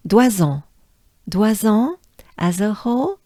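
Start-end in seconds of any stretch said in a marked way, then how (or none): 0.1-2.0 "Doisans" as dwa-zan with a nasal end; French pronunciation. Shown as a demonstration. Teacher drilling "Doisans" syllable by syllable. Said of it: "So doigt and zan".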